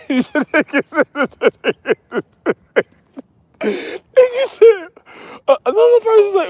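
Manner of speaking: high wheezy laughter; cracking up mid-sentence; laughing. Real, not acted.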